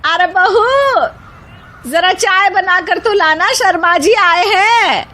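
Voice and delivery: high-pitched mother-in-law calling out; loud call. A narrator's reading. Mother-in-law calls bahu to bring tea, high loud call. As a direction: call her daughter-in-law from across the house. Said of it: "सास जोर से आवाज लगाकर कहती है".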